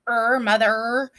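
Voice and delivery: slow "mother" with a long "er"; drawn-out ER. Shown as a demonstration. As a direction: isolate "er", then stretch it in "mother". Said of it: "Do you hear that?".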